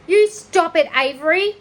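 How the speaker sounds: put-on loud, high girl's cry to stop. Fern crying out to stop Avery, loud and high. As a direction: shout him down before he strikes. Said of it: "cried Fern".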